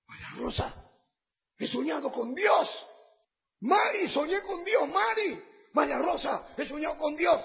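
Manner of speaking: high, eager telling of the dream; excited. Retold or imitated. Despierta a la esposa emocionado. (despertando a su esposa, emocionado y agudo)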